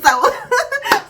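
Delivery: loud high burst of laughter. Real, not acted. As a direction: let out a high squealing laugh. Laughs after imagined quote.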